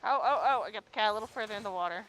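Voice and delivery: ordinary talk, nothing marked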